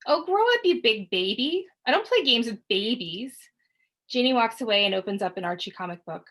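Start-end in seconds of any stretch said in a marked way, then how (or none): 0.1-3.5 high loud sneer; mock him as a baby; taunting. Sister taunting her brother, high and loud.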